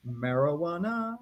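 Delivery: "Marijuana" drawn out slowly, sliding upward in pitch; imitated guitar lick. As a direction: stretch "Marijuana" like a comic rising guitar lick. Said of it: "he actually went".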